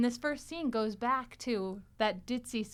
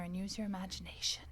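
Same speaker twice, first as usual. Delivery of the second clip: whispered, softer, a playful secret; mock whisper; 4 semitones lower, 11 dB quieter than usual. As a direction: whisper it like a little secret to the listeners. Teasing advice to listeners.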